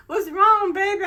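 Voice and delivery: imitating a startled husband. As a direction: squeak out his worried call. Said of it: "He's like".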